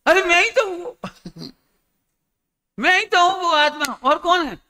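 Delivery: high, laughing, joyful claim; bubbling over as he tells her it's him; gleeful insistence. Acted. Son laughing happily as he tells his mother he is the soul.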